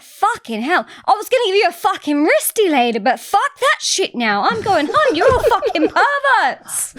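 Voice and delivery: high, hammed-up indignation; mock furious rant, breaking into laughter at the end; imitated disgusted outburst. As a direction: act out storming off in disgust. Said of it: "flipped out and she was like".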